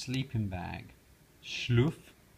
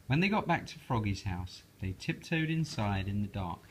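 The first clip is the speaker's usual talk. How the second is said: ordinary delivery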